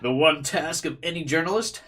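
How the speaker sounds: mocking. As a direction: sneer the words back in disbelief. Sneering echo of reporter's words.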